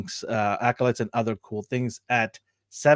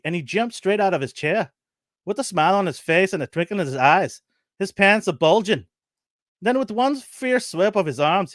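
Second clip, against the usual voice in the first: lightly put-on Irish granny, higher pitch; a hint of an Irish woman telling her tale; Irish old woman; 5 semitones higher than usual. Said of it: "Let me get my Irish accent out".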